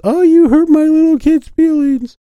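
high, loud, whiny parent voice; coddling parent mocked in high whine.

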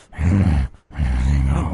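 deep far-off demon chuckle; low evil laugh from the gut; demonic laugh. Shown as a demonstration. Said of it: "but I can go like".

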